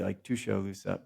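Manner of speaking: Tuscaloosa said softly as Tuscaloosa; own pronunciation. Shown as a demonstration. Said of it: "which I would say".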